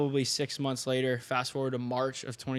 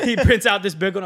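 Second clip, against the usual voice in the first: laughing, much louder; cracking up at the memory; 7 semitones higher, 11 dB louder than usual. Real, not acted. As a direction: laugh through it. Laughing narration.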